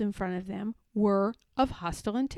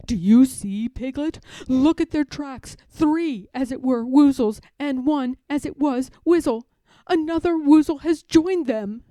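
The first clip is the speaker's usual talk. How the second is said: louder, excited bear voice; anxious Pooh; 2 semitones higher, 8 dB louder than usual. A narrator's reading. Pooh shows Piglet the tracks, loud and anxious. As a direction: point out the tracks eagerly.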